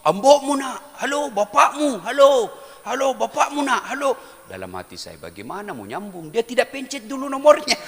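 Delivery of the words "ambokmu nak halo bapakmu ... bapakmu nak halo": high, loud calls into the phone; loud repeated halo of a confused old man; old man shouting hello. Retold or imitated. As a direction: yell hello into a dead line. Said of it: "Baru dia teriak".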